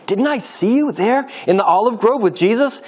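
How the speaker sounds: high, pointed challenge; accusing question. A narrator's reading. Slave recognises Peter from the olive grove, accusing question. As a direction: challenge him as if you recognise him.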